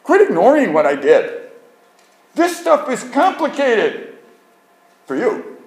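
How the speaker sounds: high, loud, exasperated pleading; God scolding. Pretend. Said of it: "God's going".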